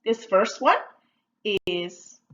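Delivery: normal talking voice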